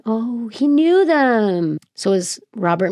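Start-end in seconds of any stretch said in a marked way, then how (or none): 0.0-1.8 dawning realization. Real, not acted. Realizing, touched.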